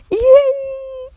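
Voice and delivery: loud, high drawn-out squeal; mock cheer. Storyteller cheering for his character, high mock squeal. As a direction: cheer way up high, held long.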